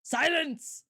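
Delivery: high, hammed-up order to hush; mock command. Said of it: "You just are automatically like".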